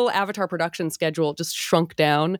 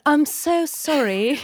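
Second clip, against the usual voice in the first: put-on mid-Atlantic accent; 8 semitones higher, slower than usual. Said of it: "mid-Atlantic accent".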